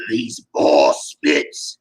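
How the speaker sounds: low, slow sneer. Acted. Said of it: "he sneered".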